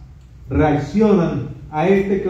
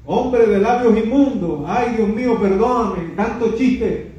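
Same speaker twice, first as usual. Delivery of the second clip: high, pleading cry of guilt; high desperate lament before God; anguished Isaiah; 4 semitones higher than usual. Retold or imitated. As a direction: beg forgiveness in terror. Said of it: "signo de admiración, de exclamación".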